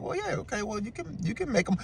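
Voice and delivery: tiny high, hushed imitation; mock her backing down, very soft and high; placating girlfriend. Girlfriend giving in over the tacos, small placating voice.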